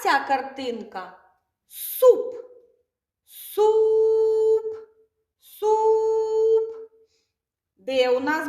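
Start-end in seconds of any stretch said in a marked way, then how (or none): 1.7-6.8 high, loud drawn-out "суп"; model word for children. Shown as a demonstration. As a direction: sing out "суп" slowly and brightly, the way a kindergarten teacher would. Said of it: "teacher models word loudly".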